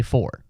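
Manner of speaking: "for" with a full OR vowel; full pronunciation. Shown as a demonstration. Showing how he says it ending a sentence, full "for". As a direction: say "for" to rhyme with door. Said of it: "at the end of the sentence, I'll say".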